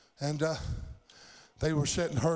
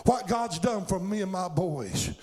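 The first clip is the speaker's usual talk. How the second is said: raised-pitch, impassioned pulpit delivery; fervent revival preacher in full cry; full-force preaching; 5 semitones higher than usual. Real, not acted. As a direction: preach it hard, driving each phrase to the congregation.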